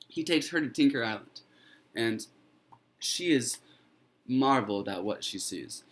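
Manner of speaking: plain reading voice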